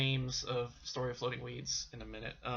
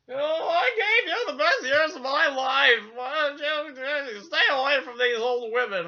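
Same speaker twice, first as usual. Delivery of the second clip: high, loud put-on woman's voice, slowing on why don't you; the mistress scolding her man in a high, loud mock voice; mock upset mistress; 11 semitones higher, 7 dB louder than usual. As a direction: ham up the jilted lover pleading. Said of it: "And she's like".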